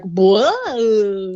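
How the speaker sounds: loud, drawn-out amazed whoa; mock astonished cop; stunned whoa. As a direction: react like the officer can't believe it. Officer stunned by Howard.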